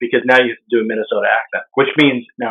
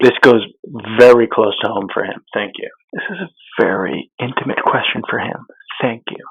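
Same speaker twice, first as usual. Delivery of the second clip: hushed golf commentator; 3 semitones lower than usual. Pretend. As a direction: murmur it reverently like a putting commentator. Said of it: "like the Golf Channel when somebody's putting".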